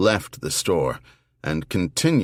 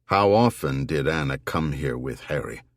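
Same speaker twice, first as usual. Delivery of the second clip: ordinary delivery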